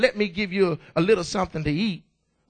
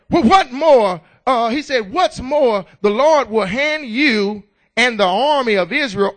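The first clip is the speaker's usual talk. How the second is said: preaching hard; 6 dB louder than usual. Real, not acted. Preaching at full force.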